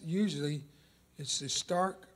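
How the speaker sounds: ordinary talk, nothing marked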